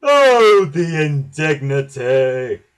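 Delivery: very loud, drawn-out outrage; indignant huff. A narrator's reading. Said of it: "huffed Gordon".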